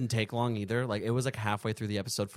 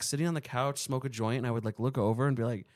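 ordinary talk

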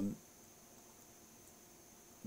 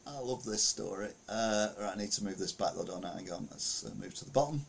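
normal talking voice

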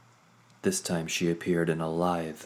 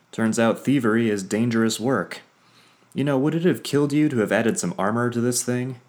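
loud, higher exasperated complaint; 6 semitones higher, 7 dB louder than usual. Acted. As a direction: vent the frustration loudly. Vondair complains after near death.

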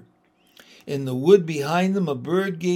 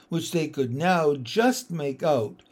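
plain reading voice

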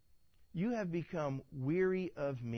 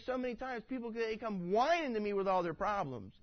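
ordinary talk, nothing marked

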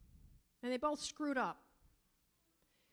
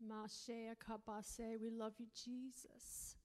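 softer, murmured prayer; quiet prayer in tongues; 4 semitones lower, 10 dB quieter, slower than usual. Real, not acted. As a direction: pray under your breath in tongues. Soft prayer.

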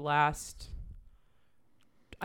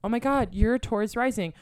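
high, loud, gushing astrology excitement; excited gushing; 6 semitones higher, 7 dB louder than usual. Retold or imitated. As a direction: gush it out, higher and louder. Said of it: "I was like".

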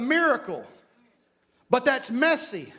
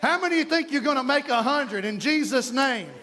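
usual speaking voice

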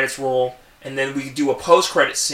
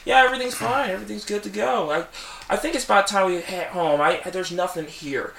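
higher, falsely calm reply; higher falsely calm Jameson after the attack; possessed calm; 5 semitones higher, 5 dB quieter than usual. Retold or imitated. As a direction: reassure them too smoothly. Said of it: "He's like".

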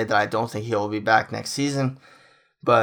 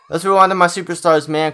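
excited; 7 semitones higher, 7 dB louder than usual. Real, not acted. Excited hype leading into star players.

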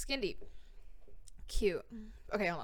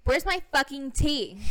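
diva demand; 5 semitones higher, 6 dB louder than usual. Acted. Diva demands her tea again.

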